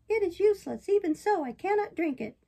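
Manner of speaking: high, loud puppet refusal; balky puppet. Acted. Puppet refusing the fairy's drink, stubborn. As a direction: refuse loudly in a high little voice.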